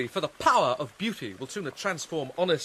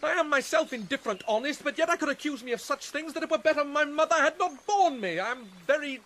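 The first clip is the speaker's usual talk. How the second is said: higher, agitated self-accusation; bitter outburst; 8 semitones higher than usual. Acted. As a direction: lash out in bitter disgust at yourself. Hamlet rails at Ophelia.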